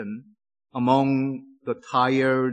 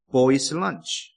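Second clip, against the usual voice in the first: ordinary talk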